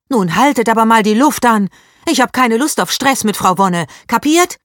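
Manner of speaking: put-on girl stepping between fighting boys; stern. A narrator's reading. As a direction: stop them firmly. Leni breaks up fight.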